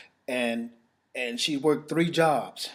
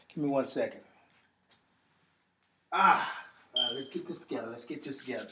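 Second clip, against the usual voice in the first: pulling himself together; 6 dB quieter than usual. Real, not acted. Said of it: "I'm very emotional about this".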